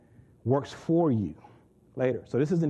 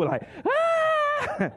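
put-on wild kids' scream. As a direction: imitate chaos breaking out. Kids running wild on tables.

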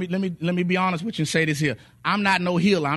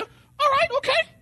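put-on much higher, small child's okay; little son's voice; slower than usual. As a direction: voice the little boy, high and meek. Son voiced by father.

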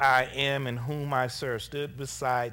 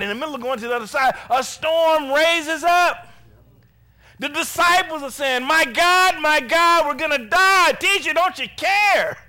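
full-force preaching; 12 semitones higher, 10 dB louder than usual. Acted. Preacher voicing panicked disciples to the teacher, full-force preaching. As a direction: build to loud, high cries.

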